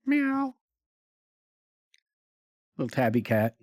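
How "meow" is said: high-pitched imitated meow; cat meow. Game master as the tabby cat at the train door, meowing. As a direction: mimic a cat's cry, pitched way up.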